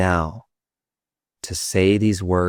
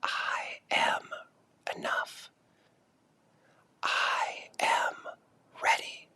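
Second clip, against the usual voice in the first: much softer, slow whispered affirmations; 12 dB quieter, slower than usual. Shown as a demonstration. Guide whispers affirmations for the group to echo. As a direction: breathe the words as a whisper. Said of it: "And now say it with a whisper".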